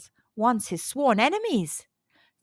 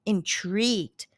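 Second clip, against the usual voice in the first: plain reading voice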